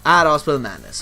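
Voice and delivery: usual speaking voice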